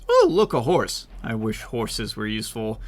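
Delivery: ordinary talk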